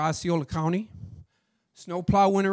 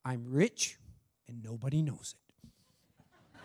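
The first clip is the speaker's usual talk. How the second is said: confiding secret; 7 semitones lower, 7 dB quieter, slower than usual. Retold or imitated. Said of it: "And she says this".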